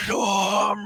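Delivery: long, high, furious-sounding bellow; drawn-out battle yell. Acted. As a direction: drag the name into a screaming roar. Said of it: "Clive just starts yelling out".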